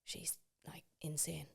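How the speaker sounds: hushed gossiping whisper. Real, not acted. Gossiping to her friend about someone, whispered. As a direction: whisper the name so nobody hears.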